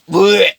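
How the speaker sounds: loud retching blech; vomiting noise. Retold or imitated. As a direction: make a gross vomiting sound. Guy vomiting.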